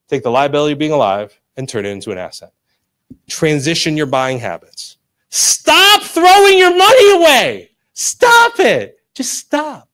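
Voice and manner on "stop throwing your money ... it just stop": high, drawn-out theatrical wail; over-the-top pleading cry; exasperated plea. Acted. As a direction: beg them loudly to stop, fading on the last words. Mock plea to stop wasting money.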